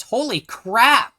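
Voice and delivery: ordinary delivery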